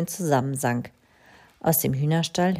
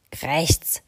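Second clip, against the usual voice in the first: caw. A narrator's reading. Crow caws.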